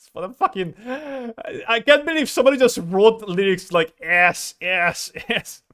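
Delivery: laughing disbelief. Real, not acted. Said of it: "It's so bad".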